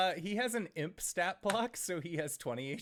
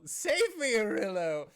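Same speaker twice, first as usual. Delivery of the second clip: high, loud cry for help; yell; 6 semitones higher, 7 dB louder than usual. Acted. Jeremiah flees Shriek's rat tail, yelling for help. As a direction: yell out for your little rescuer. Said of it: "will yell".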